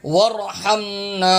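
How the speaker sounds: slow, drawn-out recitation; Quran recitation. Shown as a demonstration. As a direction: recite with a long final vowel. Teacher recites verse slowly. (ٹھہر کر تلاوت)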